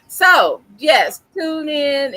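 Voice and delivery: high, loud, slow sign-off; loud outro plug. Acted. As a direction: shout the plug to viewers.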